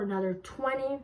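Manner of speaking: ordinary delivery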